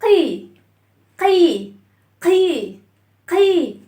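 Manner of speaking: loud, drawn-out "قي" with a clear ee; kasra sound. Shown as a demonstration. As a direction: break the vowel down into a long ee on "قي". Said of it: "هنكسر الصوت".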